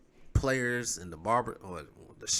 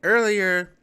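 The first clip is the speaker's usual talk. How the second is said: triumphant announcement; 8 semitones higher, 10 dB louder, slower than usual. Real, not acted. Announcing return to channel.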